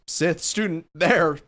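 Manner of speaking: mock scream to alert others. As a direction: call it out high, loud and slow. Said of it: "So I'm screaming out".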